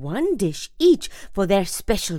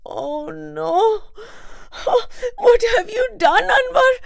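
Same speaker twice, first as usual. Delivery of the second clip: high, loud panicked wail; panicked cry; 4 dB louder than usual. A narrator's reading. Mother panics at her son's news, crying out. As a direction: cry out in alarm at the boy. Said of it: "she cried".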